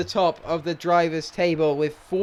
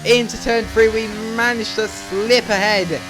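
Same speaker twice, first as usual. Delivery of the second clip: fast-flowing, urgent racing commentary; live play-by-play; 3 semitones higher, 3 dB louder than usual. Real, not acted. Gamer calling his own final-lap battle to viewers, excited play-by-play. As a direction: call the race live, raised and driving.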